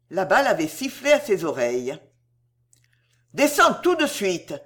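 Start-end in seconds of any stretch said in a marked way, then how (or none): 3.3-4.5 high, raised, irritated command; imperious and irritated. A narrator's reading. Officer to child in danger, imperious and irritated. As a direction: insist on instant obedience. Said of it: "d'une voix impérieuse et irritée".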